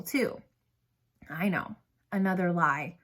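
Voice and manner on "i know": plain reading voice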